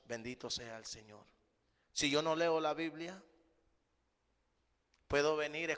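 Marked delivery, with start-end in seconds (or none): 0.1-1.2 soft aside, Bendito sea el Señor dropped low and quiet; hushed devout aside; soft murmured blessing. Real, not acted. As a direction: mutter the blessing under your breath, softer and lower. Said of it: "murmured blessing aside".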